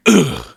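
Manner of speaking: short, loud "ugh"; imitated impact grunt. Storyteller splashing onto the concrete, loud grunt. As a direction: grunt as if winded by a fall.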